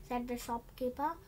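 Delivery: usual speaking voice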